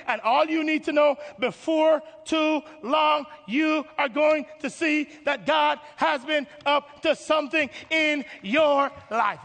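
loud, high, slow emphatic climax; full-force preaching. Real, not acted. Preacher assuring congregation God is at work, climactic shouting. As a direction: hammer out each word to the congregation.

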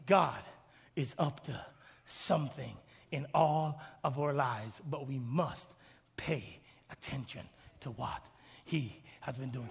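low, soft, slow and measured; solemn preaching. Real, not acted. Preacher urging congregation to pay attention, hushed solemnity. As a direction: let each phrase land with pauses.

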